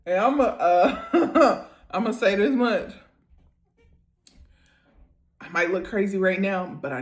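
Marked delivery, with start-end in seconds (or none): 0.1-2.9 words broken by real laughter; laughing. Real, not acted. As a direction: talk through helpless giggles. Laughing while reacting.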